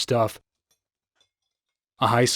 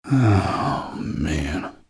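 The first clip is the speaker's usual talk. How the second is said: low, dismayed "oh, man"; appalled groan; 6 semitones lower than usual. Acted. Sheriff sickened by agent's grisly murder tale, appalled. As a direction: sigh it out, sinking low. Sheriff reacts to gruesome case.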